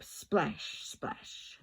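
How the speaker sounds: breathy hushed splashes, fading away; soft whispered sound effect. A narrator's reading. Sound words in read-aloud story.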